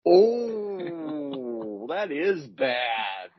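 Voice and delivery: slow groan through a laugh; amused groan at a bad pun. Real, not acted.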